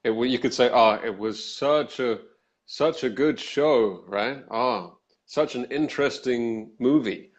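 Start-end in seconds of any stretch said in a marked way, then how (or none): 0.7-4.0 slow, mocking praise; sarcastic. Shown as a demonstration. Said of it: "it depends on the tone of voice". 4.5-7.3 slow, dry fake interest; sarcastic. Shown as a demonstration. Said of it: "it depends on the tone of voice".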